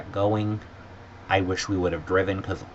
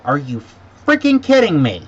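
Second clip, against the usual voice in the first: loud, high burst of outrage; retold angry outburst; 7 semitones higher, 10 dB louder than usual. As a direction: explode at the airline guy, louder and much higher. Retold outburst at airline employee.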